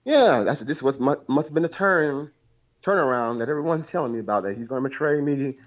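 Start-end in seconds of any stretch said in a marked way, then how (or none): none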